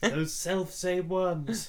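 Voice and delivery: slow, high, savoring mock solemn; slow mock gravity. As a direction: stretch it out with relish. Savouring the bad phrasing.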